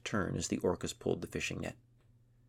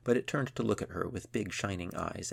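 ordinary delivery

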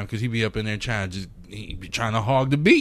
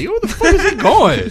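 high, loud incredulous outburst through a laugh; retold disbelief; 9 dB louder than usual. Retelling his shock at a friend's video, laughing high outburst.

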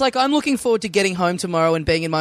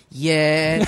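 low, drawn-out mock defeat; broken-spirited resignation; 4 semitones lower than usual. Teasing a friend's broken spirit, mock resigned voice. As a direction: imitate a defeated, resigned yeah. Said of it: "you going".

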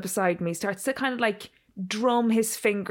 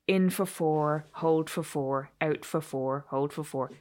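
calm breathing count; 4 semitones lower, 4 dB quieter, slower than usual. Shown as a demonstration. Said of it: "try and breathe deeply".

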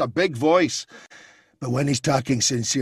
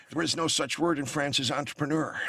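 George W. Bush; 5 dB quieter than usual. Retold or imitated. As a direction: lightly play George W. fumbling. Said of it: "became George W".

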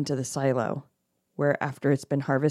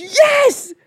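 high, loud thrilled yes; excited; 12 dB louder than usual. Real, not acted. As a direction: burst out in agreement. Eager agreement.

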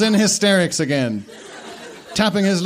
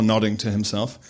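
ordinary delivery, nothing marked

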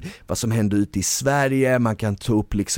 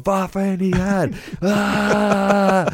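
high hyped shout ending in a cry; pep-talk yell; 9 semitones higher, much slower than usual. Retold or imitated. Boss yelling at his salespeople, why are you here. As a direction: scream at the sales team to get them going. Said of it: "För jag skriker så mycket".

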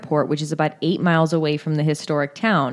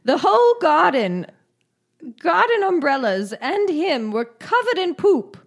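high-pitched mock British accent; 8 semitones higher than usual. Co-host quotes councillor on poop-covered garden, mock British accent. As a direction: lay the bad British accent on thick. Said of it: "decided to do an accent".

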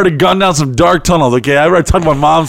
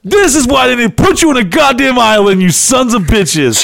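mock angry rant; 7 semitones higher than usual. Angry rant about Henry.

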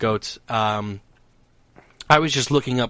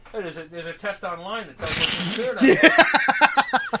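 imitating Dom; 3 semitones higher, slower than usual. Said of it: "this is exactly what he said".

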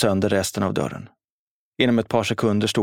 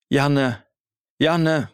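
ropar namnet högre andra gången; förtvivlat rop; 5 semitones higher than usual. A narrator's reading. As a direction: desperat rop efter sonen. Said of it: "skrek mamma". (skadad mamma ropar förtvivlat efter sin son)

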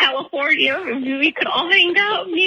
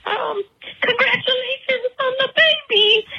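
tearful advice; 7 semitones higher than usual. Real, not acted. Caller crying while giving advice.

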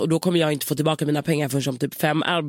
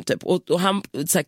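ordinary talk

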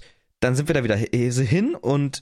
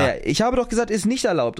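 higher pitched, insistent scolding; angry caretaker; 8 semitones higher than usual. Retold or imitated. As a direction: tell them off like an angry caretaker. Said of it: "da ist er wütend geworden".

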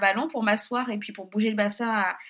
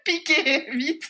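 mock impatient, laughing; laughing urging; 6 semitones higher, much slower than usual. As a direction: hurry him along through laughter. Presse l'anesthésiste en riant. (impatience amusée en riant)

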